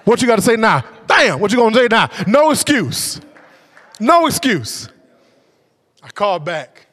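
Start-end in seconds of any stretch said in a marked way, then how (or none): 0.1-4.9 taunting preach. Acted. Preaching at full force.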